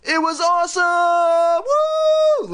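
high, loud, slow squealing cheer; excited exclaim. Acted. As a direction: shout it out, thrilled. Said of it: "Lindy exclaimed".

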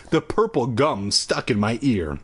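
ordinary delivery

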